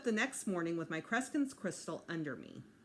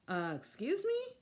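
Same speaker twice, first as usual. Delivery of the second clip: sarcastic; 11 semitones higher than usual. Acted. Peter exasperated at table.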